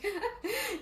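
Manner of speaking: short high laugh; delighted giggle; burst of laughter. Real, not acted. Laughs at the viewer's support.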